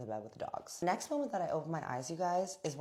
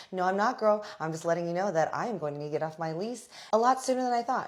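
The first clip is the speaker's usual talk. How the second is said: loud; 5 semitones higher, 7 dB louder, faster than usual. Retold or imitated. Breaking news to landlord loudly.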